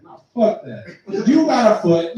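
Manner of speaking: ordinary delivery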